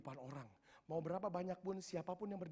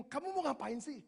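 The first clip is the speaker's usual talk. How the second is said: high, louder incredulous questions; voiced bystanders asking in raised, bewildered disbelief; puzzled onlookers; 8 semitones higher, 5 dB louder than usual. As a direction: imitate baffled people challenging a newcomer. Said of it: "orang waktu itu lihat dan bingung".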